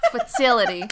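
"Fertility" in a mock New York accent through laughter; loud laughing New York "Fertility". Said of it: "your whole New York accent came out".

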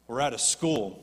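normal talking voice

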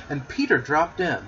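ordinary delivery

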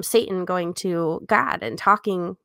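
normal talking voice

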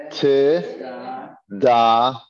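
loud, split syllables T and da sounded out. Shown as a demonstration. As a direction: call out "T, da" slowly, one syllable at a time. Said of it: "Say that again".

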